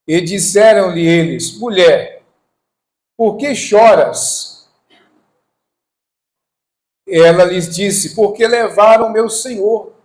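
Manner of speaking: loud, high declaimed verse; scripture reading. Acted. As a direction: proclaim the Bible dialogue from the pulpit. Reads scripture aloud to congregation.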